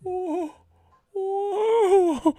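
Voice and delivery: very high, loud, stretched wail; high mock wail. Mimicking the screaming manager, very high wail.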